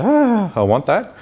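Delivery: high, drawn-out "ah" of wanting; craving response. Shown as a demonstration. Speaker demonstrating the want response to listeners, craving. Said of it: "So you got the".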